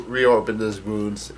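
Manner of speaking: ordinary delivery, nothing marked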